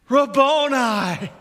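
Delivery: high, loud, drawn-out cry of recognition; crying out Rabboni. Retold or imitated. Mary crying out to the risen Jesus, a high loud cry. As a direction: call out his name in overwhelmed joy. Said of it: "cried out in Aramaic".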